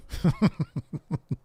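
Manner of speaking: short bubbling laugh; real chuckle. Real, not acted. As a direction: laugh lightly after your own rant. Laughing after own rant.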